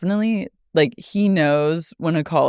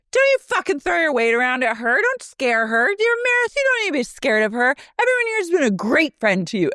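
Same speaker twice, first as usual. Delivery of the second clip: higher, pushy defensive imitation; mock Heather; 8 semitones higher than usual. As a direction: defend Meredith like an indignant Heather. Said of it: "And Heather is".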